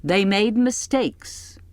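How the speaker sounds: put-on a preachy matron lecturing the girls; pious, condescending. A narrator's reading. Aunt Lydia lecturing the girls, pious and condescending. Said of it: "Her voice is pious, condescending".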